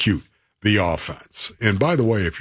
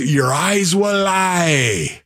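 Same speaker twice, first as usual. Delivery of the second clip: raised, drawn-out Scottish coach; Scottish accent; 6 semitones higher, much slower than usual. Acted. Host repeats his old coach's saying, Scottish accent. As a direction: stretch it out like a yelling Scottish coach. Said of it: "his accent through your impression".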